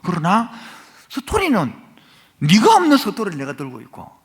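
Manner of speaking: loud, high, impassioned sermon climax; preaching at full force. Real, not acted. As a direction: drive it home to the congregation, rising.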